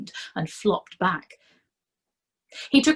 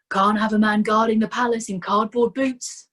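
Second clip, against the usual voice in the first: brisk, louder, put-on jolly tone; mock cheerfulness; 4 semitones higher, 6 dB louder than usual. A narrator's reading. Vimes swapping boots with the constable, mock cheerful. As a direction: fake good cheer to hide the cold. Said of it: "said Vimes with mock cheerfulness".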